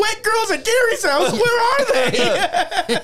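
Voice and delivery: high, laughing eager question; eager kid. Retold or imitated. Boy at the computer, eagerly high and laughing. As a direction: perk up like an excited kid. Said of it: "And you're like".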